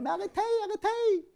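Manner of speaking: high, frantic pleading; panicked old rabbi begging them to stop. Acted. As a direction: squeal it in panic. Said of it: "il est affolé".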